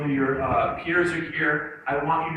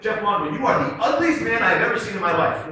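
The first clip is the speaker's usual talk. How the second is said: retold insult; 4 semitones higher, 5 dB louder, faster than usual.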